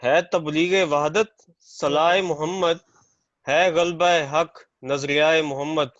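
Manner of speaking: measured, projected verse delivery; poetry recitation. Real, not acted. Poet reciting a naat at a mushaira, poetry recitation. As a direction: recite the couplet out to an audience.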